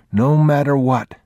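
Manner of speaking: put-on high child's echo of a promise; little boy repeating it, pitched high; young boy. A narrator's reading. Boy echoing his father's words, high childlike voice.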